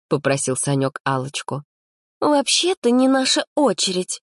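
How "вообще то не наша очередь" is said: capricious. Acted. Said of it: "капризно скривила губки Алла".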